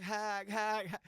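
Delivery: high-pitched dying porcupine hacks; imitated animal honk. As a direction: mimic a wounded animal's repeated cry.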